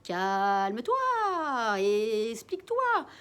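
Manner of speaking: slow drawn-out squawking parrot; parrot mimic. Jaco the parrot echoing the father, slow and squawked. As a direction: stretch the words like a parrot.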